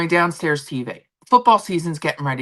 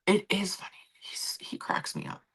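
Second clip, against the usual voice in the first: whispered aside, much softer; 4 semitones higher, 10 dB quieter than usual. Real, not acted. Vlogger leaning close to the laptop, whispering an aside. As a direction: confide it under your breath. Leans into laptop, confides softly.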